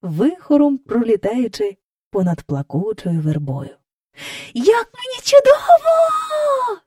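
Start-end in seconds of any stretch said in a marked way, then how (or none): 4.5-6.8 high, drawn-out joyful yell; calling out. A narrator's reading. Child flying over the park, shouting with delight. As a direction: stretch it into a loud, delighted cry. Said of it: "гукав Майкл".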